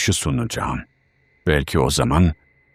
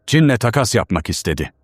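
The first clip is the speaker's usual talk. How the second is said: ordinary delivery, nothing marked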